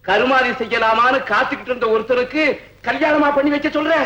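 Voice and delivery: indignant. Acted. Father rejecting marriage to a man he expected dead, indignant. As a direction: build the question into outrage.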